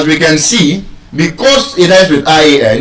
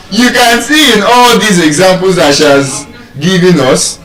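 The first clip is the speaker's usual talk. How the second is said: normal talking voice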